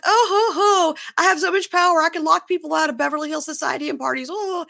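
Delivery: high-pitched fake sobbing wail; hammed-up pretend weeping, swooping high on each oh; mock crying. Imitating Kathy pretending to cry over her hanky, mock crying. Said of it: "pretends to cry".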